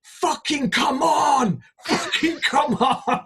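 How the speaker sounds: high, slow, hammed-up yell, the second one laughing; imitated hype shout. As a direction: mimic a DJ roaring the crowd on. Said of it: "He goes".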